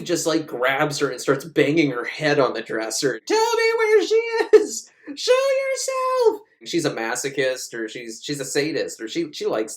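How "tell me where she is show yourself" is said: hammed-up shouting at a possessed woman; mock yell. Steve slamming her head on the dresser, shouting high and loud.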